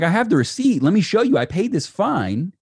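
high-pitched, insistent pleading; retold protest. Driver telling officer he has the receipt, high indignant protest. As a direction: argue your case urgently, pitch jumping up.

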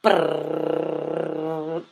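rolled-lips drumroll sound; mouth drum roll. Pretend. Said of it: "I'm gonna do a drum roll".

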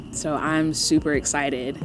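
ordinary delivery

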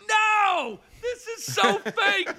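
very high, drawn-out protest; mock horrified cry. Shocked boyfriend watching fake tongues, high mock wail. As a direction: squeal it in hammed-up disbelief. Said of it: "I was like".

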